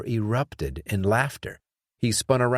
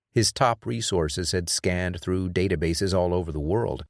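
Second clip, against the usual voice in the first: ordinary delivery, nothing marked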